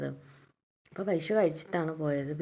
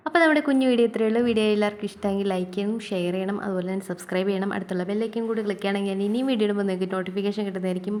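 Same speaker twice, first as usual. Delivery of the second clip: fast, louder sign-off pitch; vlog outro; 3 semitones higher, 7 dB louder, faster than usual. Acted. Vlogger asks viewers to like, share and subscribe, in a fast upbeat outro. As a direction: rattle off the like and subscribe plea.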